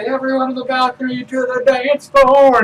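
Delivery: high, loud stage call; rally everyone from the microphone; calling the crowd. Acted. Singer on stage summoning all to the dance floor, high and loud.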